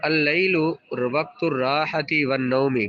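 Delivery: ordinary talk, nothing marked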